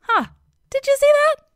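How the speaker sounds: high, loud, eager question; excited boast. Acted. Dionysus to his slave as Heracles collapses laughing, excited.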